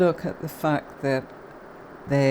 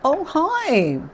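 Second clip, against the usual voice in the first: bright startled "oh, hi" pitched much higher and louder; surprised greeting; 13 semitones higher, 6 dB louder than usual. Real, not acted. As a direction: greet the unexpected guest with delight. Greeting a surprise live guest.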